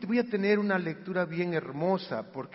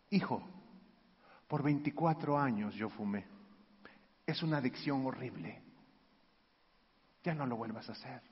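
softer and lower, a tender father speaking; soft, low father talking to his son about smoking; gentle fatherly warning; 8 semitones lower, 11 dB quieter than usual. Retold or imitated. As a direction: hush it down like a kind dad who isn't angry.

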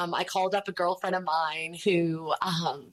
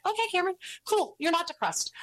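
much higher, gently humoring a struggling friend; sweet gentle friend; 11 semitones higher, faster than usual. Retold or imitated. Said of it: "Kana Hickman was so sweet".

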